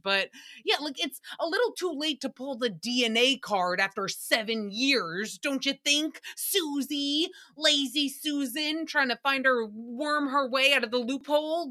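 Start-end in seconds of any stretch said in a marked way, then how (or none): none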